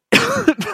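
sudden choking cough. Real, not acted. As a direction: cough hard, caught on something. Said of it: "je m'étouffe tout seul".

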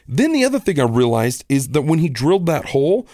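ordinary talk

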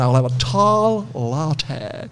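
slow, drawn-out American imitation; hammed-up American coffee order; dodgy American accent. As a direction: put on a bad American accent, very slowly. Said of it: "my dodgy American accent".

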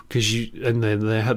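usual speaking voice

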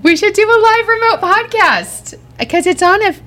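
very high, thrilled squeal; excited outburst. Real, not acted. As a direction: squeal the big idea with glee. Bursts out with the idea.

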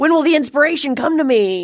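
high, hammed-up plea; mock-dramatic wail to the muse; drunken tortured artist. Co-host imitating a drunk artist seeking inspiration, mock anguish. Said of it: "getting drunk and going".

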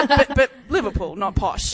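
normal talking voice

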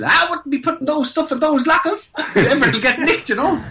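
mock warning with a grin; imitating a local. Gym regular warns the Irish lads, high put-on voice. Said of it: "some went".

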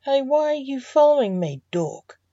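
mildly snappish, dropping on the last word; snapped. A narrator's reading. Boy catches his dad following him, snapped. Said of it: "snapped the boy".

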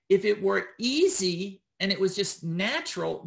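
ordinary delivery

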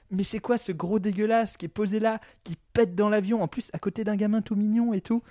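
lightly acted disgusted disapproval; imitated contempt. Man voicing nuns judging his father on the plane, mock contempt. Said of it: "d'un air de mépris, genre".

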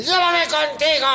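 high, drawn-out cartoon plea; little bread. Little bread asking the girl along, comic pleading. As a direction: beg in a silly high creature voice. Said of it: "pequeño pan".

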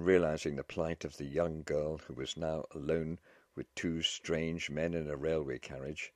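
usual speaking voice

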